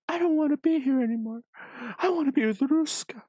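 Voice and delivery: a hint of a pining young wife; Octavia. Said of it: "Octavia's like".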